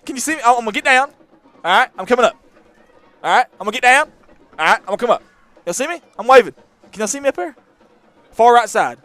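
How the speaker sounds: high, loud playful calls; excited calling out. Real, not acted. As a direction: shout up to the fans, having fun. Calling to fans from the press box.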